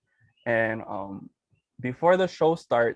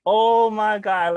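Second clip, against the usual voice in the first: high, slow, stunned "Oh my God"; retold thrilled squeal; excited gasp; 8 semitones higher, 7 dB louder, much slower than usual. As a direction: drag it out in thrilled disbelief. Self-quote on hearing news.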